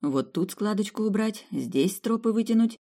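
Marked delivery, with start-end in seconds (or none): none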